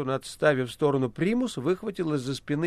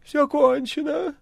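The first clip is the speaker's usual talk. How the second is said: high, slow, feeble cat voice; weak dying voice; 11 semitones higher, 3 dB louder, slower than usual. Acted. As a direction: fade out like a dying hero. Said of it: "слабым голосом сказал кот".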